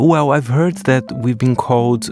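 ordinary talk

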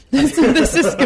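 real laughter breaking through the words; laughing. Real, not acted. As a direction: crack up while saying it. Cracking up while tasting.

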